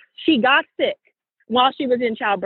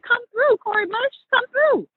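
much higher, calling out in excitement; hype cheer; 13 semitones higher than usual. Real, not acted. Host cheering Cori Bush's victory, high excited call. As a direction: cheer her on like a fan.

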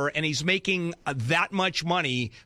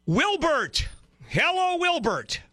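calling out; 13 semitones higher, slower than usual. Real, not acted. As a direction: call the name across the line. Host calls out to caller.

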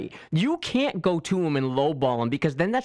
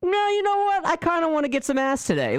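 much higher, excited mock voice, a bit louder; sudden eager turnaround; 13 semitones higher, 4 dB louder, faster than usual. Dater suddenly wanting attention again, squeaky and eager. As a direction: jump way up in pitch, suddenly keen. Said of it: "you're just kinda like".

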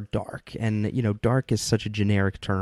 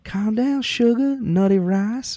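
high, loud Mississippi drawl; hammed-up Southern belle in a Mississippi accent, pitched way up; Rogue's Mississippi accent; 13 semitones higher, 5 dB louder, slower than usual. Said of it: "Mississippi accent".

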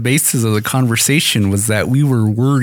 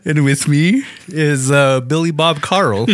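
cowboy voice; 4 semitones higher, slower than usual. Pretend. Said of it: "we were talking in that manner".

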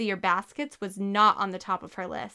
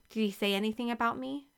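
ordinary delivery, nothing marked

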